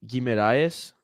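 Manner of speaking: "Guimarães" as gee-meh-DYE-ess, Spanish-style; Spanish accent. Shown as a demonstration. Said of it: "like, in a Spanish accent".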